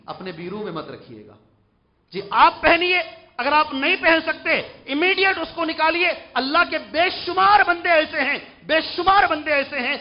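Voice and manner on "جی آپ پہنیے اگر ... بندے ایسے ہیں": high, loud pulpit exhortation; urgent preaching. Acted. Preacher urging the congregation to give clothes away, urgent preaching. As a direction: press them to act right now.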